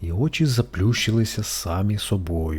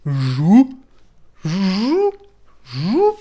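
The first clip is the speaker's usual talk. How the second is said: high, loud beetle shriek; loud shrieking buzz; 8 semitones higher, 6 dB louder, much slower than usual. A narrator's reading. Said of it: "зазрищав Жук голосно". (жук голосно верещить)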